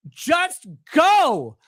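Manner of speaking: high, exasperated yell; loud comic outburst of impatience; impatient shout. Retold or imitated. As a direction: holler impatiently at someone holding up the road. Mock-yelling at a bridge jumper.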